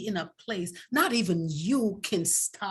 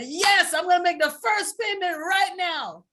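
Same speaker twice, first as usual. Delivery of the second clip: eager yes; 8 semitones higher, 4 dB louder than usual. Retold or imitated. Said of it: "But I said".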